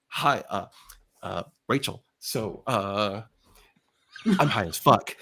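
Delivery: low, halting, awkward delivery; sheepish stoned confession. Retold or imitated. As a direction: hesitate your way through, pitch dropped. Acts himself confessing to neighbour, stoned.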